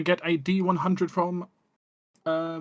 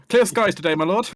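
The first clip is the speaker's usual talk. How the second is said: loud, high weather herald to a lord; raised servant's proclamation; weather reporter NPC; 4 semitones higher, 6 dB louder than usual. Acted. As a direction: announce the forecast eagerly to your lord. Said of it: "just your weather reporter".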